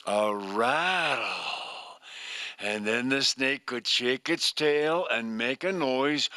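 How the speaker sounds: ordinary delivery, nothing marked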